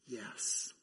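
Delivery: breathed, unvoiced yes; the kneeling woman answering Jesus in a whisper; humble whisper.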